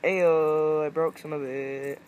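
loud exclamation. Real, not acted. Discovers frozen can breaking.

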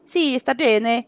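slow, hammed-up Italian; mock Italian. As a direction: play it like a cartoon Italian. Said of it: "je parle, euh, italien".

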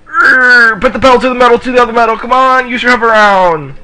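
high strained racing holler; high, effortful gamer yell; urging yell. Real, not acted. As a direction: urge the kart on, pitched high. Urging the racer at the start.

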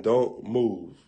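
ordinary delivery

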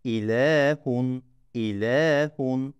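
slow, stretching "İlehun" on the L vowel; slow drawn-out "ilehun"; lengthened L. Shown as a demonstration. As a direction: hold the "le" in "İlehun" one beat. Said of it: "L'yi bir miktar uzatıyorum".

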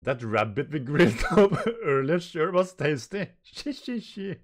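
loud, laughing carefree voice; Luffy. Acted. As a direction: laugh through it, ending in high hissed chuckles. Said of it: "And Luffy sitting".

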